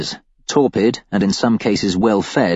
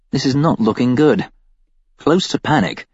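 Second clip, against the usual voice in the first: ordinary delivery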